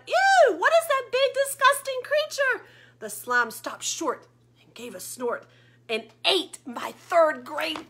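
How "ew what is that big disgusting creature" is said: much higher, loud disgusted shriek; squealing disgusted teacher; teacher shouting. Acted. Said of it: "Miss Fidget shouted".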